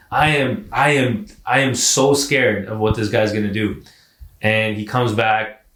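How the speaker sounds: usual speaking voice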